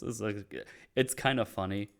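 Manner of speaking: ordinary talk, nothing marked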